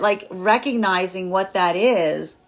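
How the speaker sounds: normal talking voice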